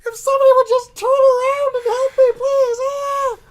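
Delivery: very high, wailing pleas; hammed-up screaming in agony; mock agonised scream. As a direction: beg and shriek as if on fire. Burning man screams for help offstage.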